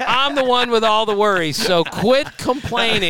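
mock scold the moping young writer; manager Zim. Manager retold telling a young reporter to stop whining, mock stern. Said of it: "He said".

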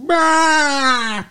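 loud, high cartoon cry; mock bawling wail. Son bursts in on his dead dad, hammed-up bawl. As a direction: wail like a crying Muppet. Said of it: "he's all like".

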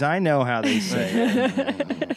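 laughing, slow and high boast. Real, not acted. Host insisting he knows the Jersey way, laughing. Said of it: "laughing boast".

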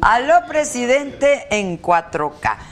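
loud, slow, high show-intro shout; announcer voice. Pretend. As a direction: call it out like a TV announcer. Mock announcer shout of show name.

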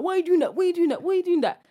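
high, loud, repeated protest; crowd outcry. Retold or imitated. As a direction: imitate onlookers calling out in alarm. Crowd's reaction acted out.

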